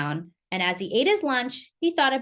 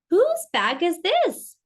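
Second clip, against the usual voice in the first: teacher asking; 7 semitones higher, 4 dB louder, slower than usual. A narrator's reading. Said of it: "asked the teacher".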